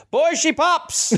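high, slow drawled heckler voice; heckler in crowd. Retold or imitated. Comic retelling a heckle at her show, high slow drawl. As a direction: stretch it out high like a rowdy man in the crowd. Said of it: "and someone goes".

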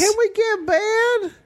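put-on high squeaky child voice; small kid asking, very high. Playing the child.

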